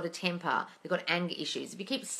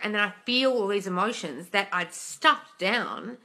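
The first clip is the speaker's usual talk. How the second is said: usual speaking voice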